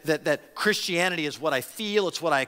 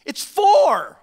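much higher and louder, insistent no; exasperated retort; 11 semitones higher, 7 dB louder, much slower than usual. Retold or imitated. Preacher's congregation answering a bad math claim, loud exasperated shout. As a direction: shut the nonsense down, loud and high. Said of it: "we're like".

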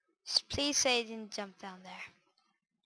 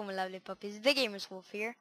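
ordinary delivery

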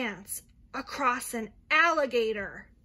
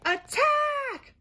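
high shouted war cry; battle cry; 13 semitones higher, 5 dB louder than usual. Acted. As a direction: yell it like charging troops. Ants charge in the story.

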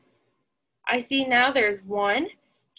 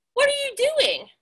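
high bright sheep voice, much higher; friendly sheep says hello; cheery sheep greeting; 12 semitones higher than usual. Acted.

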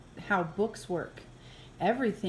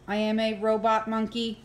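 ordinary delivery

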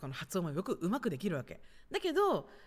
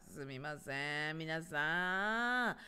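very slow, stretched apology to viewers; drawn-out apology; much slower than usual. Real, not acted. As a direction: drag out each ending, rising at the last. Apologises to viewers after camera cut. (語尾を長く伸ばして謝る)

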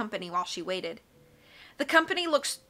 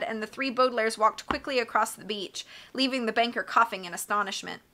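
ordinary delivery

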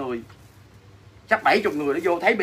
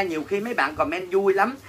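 usual speaking voice

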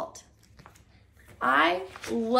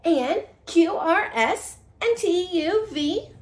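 lively storybook read-aloud; reading to children; 2 semitones higher than usual. Acted. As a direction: read it like a bedtime picture book. Reads picture book to children.

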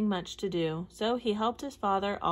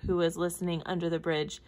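ordinary delivery

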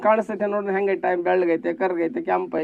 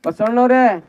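high, loud acted retort; impassioned appeal; 7 semitones higher, 8 dB louder than usual. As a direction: call out the devotee's answer, high and forceful. Voices Nilambike answering Basavanna.

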